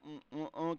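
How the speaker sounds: savoring hum. Shown as a demonstration. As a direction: hum as if smelling good food. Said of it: "اس چیز کے لیے ہم یہاں پر".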